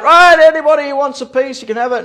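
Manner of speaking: mock call-out to the room, easing off at the end; retold yell. Man offering everyone cheesecake, imitated yell. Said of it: "he yells out".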